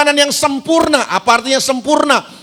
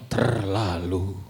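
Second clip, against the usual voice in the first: slow, much lower, drawn-out "terlalu"; 12 dB quieter, much slower than usual. Retold or imitated. Preacher joking to congregation about unfair pay: slow low mock catchphrase. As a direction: drag the word down low like the dangdut star. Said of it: "Kalau menurut [name]".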